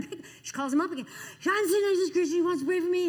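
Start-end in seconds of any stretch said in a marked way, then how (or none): 1.1-3.1 high, loud upset phone call, acted; hammed-up high-pitched outrage; distraught complaint. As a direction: imitate her wailing to her husband. Upset woman voiced on the phone.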